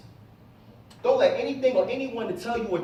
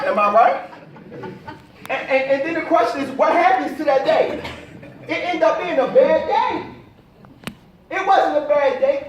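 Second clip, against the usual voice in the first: excited; 6 semitones higher, 9 dB louder than usual. Real, not acted. Rising excitement to audience.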